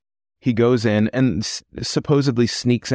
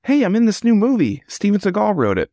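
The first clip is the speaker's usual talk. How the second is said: excited new actor; 10 semitones higher than usual. Pretend. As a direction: play a thrilled actor bragging about the gig. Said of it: "Imagine showing up to work".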